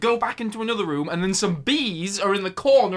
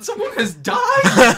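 louder, higher half-shout through laughter; laughing outrage; 9 dB louder, slower than usual. Real, not acted. Reviewer mocking the film's police, laughing outburst. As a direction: laugh while protesting.

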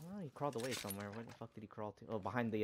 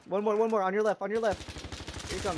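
loud, high combat callouts; urgent shouting; 12 semitones higher, 13 dB louder than usual. Real, not acted. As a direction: shout warnings to your teammate.

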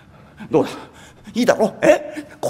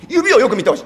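normal talking voice

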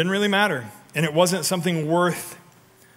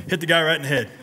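ordinary delivery

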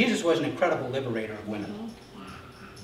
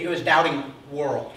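ordinary talk

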